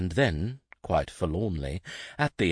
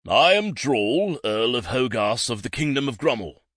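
pompous announcement; 6 semitones higher, 5 dB louder than usual. A narrator's reading. Earl introduces himself to the guard, grandly. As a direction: announce yourself with pride. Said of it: "not the least bit embarrassed".

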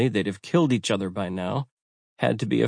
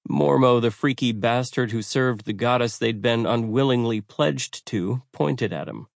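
ordinary delivery, nothing marked

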